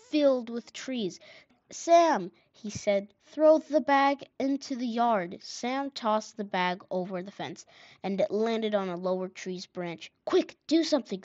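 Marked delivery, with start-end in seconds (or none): 1.7-2.3 higher, louder call to a brother; raised storybook call. A narrator's reading. Boy calls brother to throw bag. 3.3-5.4 raised, higher instruction; louder storybook call. A narrator's reading. Boy directs brother at the fence. 10.2-11.2 higher-pitched urgent plea; narrator's mild squeal. A narrator's reading. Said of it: "Sam squealed".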